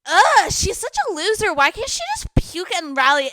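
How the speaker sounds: high, loud mocking sneer; scornful drunk friend. Drunk friend sneering as she storms out, high and loud. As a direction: sneer like a bratty drunk girl.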